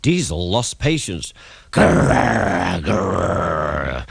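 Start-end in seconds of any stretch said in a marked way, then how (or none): 1.7-4.1 mock engine roar; roaring growl. A narrator's reading. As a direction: growl while heaving hard. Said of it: "he roared".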